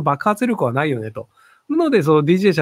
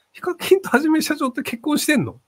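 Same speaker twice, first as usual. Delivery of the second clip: surprised; 10 semitones higher than usual. Real, not acted. Surprised by viewer's question.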